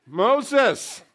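high, very loud summons; booming call. Acted. Preacher answering who else in Bible, booming acted call. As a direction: call the name out across a great distance.

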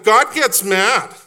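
loud, high and slow, every word struck; emphatic declaration. Acted. Pastor answering his own question, thundering slow. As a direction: proclaim it like a verdict. Climax of refrain.

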